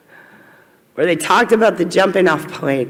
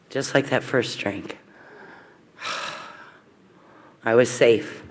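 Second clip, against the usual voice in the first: hushed, sighing, much softer; soft relief; 8 semitones lower, 9 dB quieter than usual. Real, not acted. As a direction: breathe it out in relief. Remembering relief at meetings.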